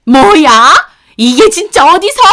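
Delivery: shrieking high and loud; explode, menacing; outraged mother-in-law. Retold or imitated. Mother-in-law advancing threateningly, enraged shriek. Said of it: "저를 때리기라도 하시듯 위협적으로".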